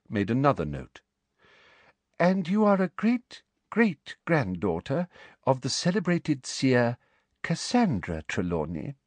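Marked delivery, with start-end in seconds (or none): 2.2-9.0 sugary high-pitched official woman; sweet, prim and very high; prim high Umbridge voice. Acted. Umbridge questions Trelawney.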